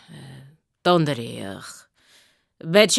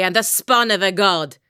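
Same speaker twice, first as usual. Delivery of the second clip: usual speaking voice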